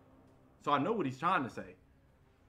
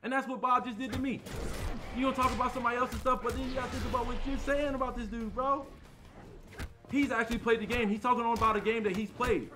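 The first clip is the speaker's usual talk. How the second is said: worked-up, higher-pitched rant; heated; 6 semitones higher than usual. Real, not acted. Streamer calling out a critic for unfair talk, heated. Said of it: "No, I was heated".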